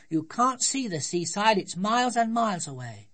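put-on high, loud scolding child; childish telling-off; severe little girl. A narrator's reading. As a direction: correct daddy firmly in a high voice. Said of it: "said Sophie severely".

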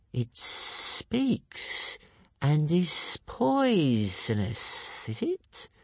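very slow, sibilant, whispery monster; hissing creature. A narrator's reading. Monster puzzling over talking food, slow hissing voice. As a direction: stretch the hisses, lifting high at the end. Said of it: "hissed the creature".

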